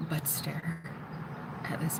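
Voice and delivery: whispered aside. Real, not acted. Staring at the man nearby, whispering to camera. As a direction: hush the words so he can't hear. Gazing at a stranger.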